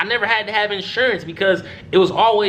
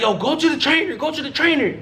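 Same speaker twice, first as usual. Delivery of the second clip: higher-pitched imitation of an urgent teammate; retold urgent order; 8 semitones higher than usual. Teammate sending injured player to trainer, retold urgency. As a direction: send him off urgently, pitched up.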